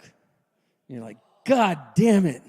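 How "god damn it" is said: exasperated outburst. Retold or imitated.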